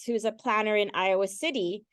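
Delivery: usual speaking voice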